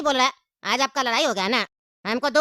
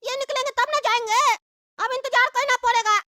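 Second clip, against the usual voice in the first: put-on high child's voice, scheming and hungry; a kid talking to himself about food; 11 semitones higher than usual. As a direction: play a greedy little kid plotting. Child character voice again.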